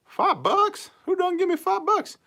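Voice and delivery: high falsetto squeal of disbelief; mock astonishment. Streamer reacts to a five-dollar donation, mock-astonished falsetto.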